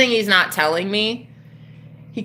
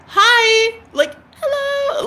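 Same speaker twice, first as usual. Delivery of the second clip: mock sweet greeting; 13 semitones higher, much slower than usual. Imagined sweet greeting instead.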